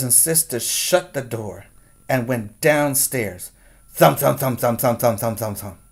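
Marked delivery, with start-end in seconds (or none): none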